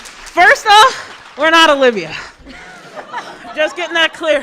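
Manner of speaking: loud, high excited clarification; excited shout. Real, not acted. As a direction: shout it with joy.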